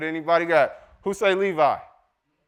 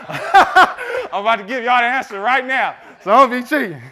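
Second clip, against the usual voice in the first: loud, higher, through laughter; laughing tease; 9 semitones higher, 8 dB louder than usual. Real, not acted. Preacher teasing congregation about cheating on the quiz, laughing. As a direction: tease them loudly with a laugh.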